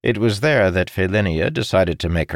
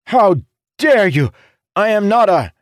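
much higher, loud indignant cry; outraged; 7 dB louder than usual. A narrator's reading. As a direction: sputter in offended fury. Jacob outraged by the insult.

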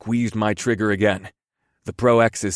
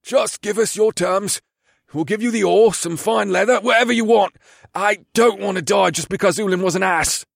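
pleading orc bandit; 10 semitones higher than usual. Acted. Orc leader begging for his life.

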